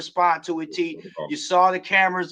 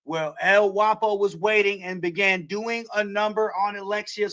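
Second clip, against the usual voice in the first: usual speaking voice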